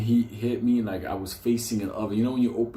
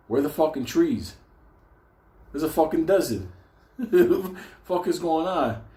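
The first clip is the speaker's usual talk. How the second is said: retold bewilderment; 6 semitones higher than usual. Acts out shock at seeing desert.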